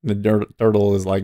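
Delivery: ordinary talk, nothing marked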